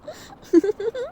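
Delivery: high, delighted vampire laugh; giddy laugh. Acted. Vampire to her victim after the first sip, giddy laughing. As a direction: laugh like the first taste after a month's fast.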